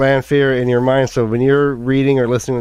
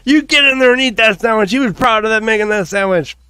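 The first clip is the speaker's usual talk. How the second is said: high, insistent imitation of ordering someone about; mock scolding; 7 semitones higher than usual. Voicing a sandwich maker ordering someone to eat, mock scolding. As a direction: scold in a raised, higher voice, playing it up.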